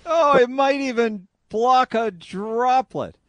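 high, loud, slow mock echo. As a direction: parrot it back with drawn-out mock wonder. Mocking echo of the played clip.

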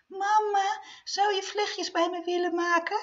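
put-on high squeaky little girl voice; piepstemmetje. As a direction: squeak it like a small girl asking sweetly. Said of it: "toen zei hij met een piepstemmetje". (hoog piepstemmetje van een klein meisje)

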